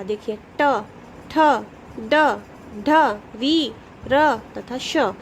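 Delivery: slow, separate retroflex sounds; each sound set apart, ending slow; letters said clearly. Shown as a demonstration. Teacher to children, demonstrating retroflex letters slowly and clearly. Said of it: "अब आप इसको बोल के देखेंगे".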